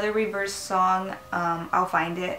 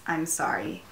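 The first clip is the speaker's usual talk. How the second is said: ordinary delivery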